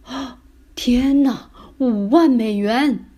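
slow, loud, drawn-out astonishment; amazed shout. Acted. Dad spotting a huge banknote in the hole, shouting amazed. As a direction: cry out at an incredible find. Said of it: "爸爸喊道".

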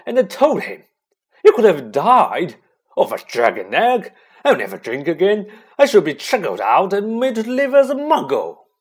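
loud, high wail of remorse; grief and remorse. A narrator's reading. Hagrid confesses to Harry in floods of tears, remorseful. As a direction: wail it out, building in pitch and volume. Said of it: "shaking with grief and remorse".

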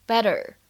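"Better" with the double t as a quick American flap. Shown as a demonstration. Teacher shows learners American "Better" with a flap T. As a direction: tap the tt in "Better", between t and d. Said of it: "是一个闪音".

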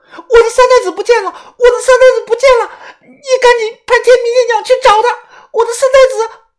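high, loud hysterical cry; frantic shouting. Acted. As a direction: scream it out in panic. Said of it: "大声的又喊".

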